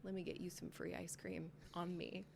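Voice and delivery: gently calm her down; soft apology. Retold or imitated. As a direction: cashier soothing the angry customer, softly. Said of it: "I was like".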